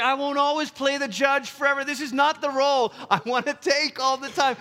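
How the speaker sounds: high-pitched, insistent refusal; emphatic protest. Acted. God declining to always play judge, emphatic protest. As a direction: push back against an unwanted role, pitched high.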